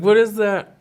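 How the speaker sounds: mock-alarmed son; imitated worry. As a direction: pitch jumps up as an imitated worried kid. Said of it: "But he starts worrying".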